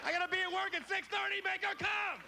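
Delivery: high-pitched furious yelling; pissed-off neighbor. Acted. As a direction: yell your angry demand at the noisy couple. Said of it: "pissed off".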